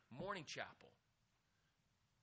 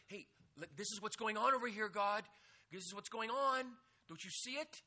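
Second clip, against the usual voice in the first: impatient complaint; 5 semitones higher, 8 dB louder than usual. Retold or imitated. Mocking impatient prayer to God.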